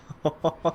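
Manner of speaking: brief amused laugh at his own joke; short chuckle. Acted. Forensic expert laughing at the victim's migraine: short chuckle. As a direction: chuckle at the grim image.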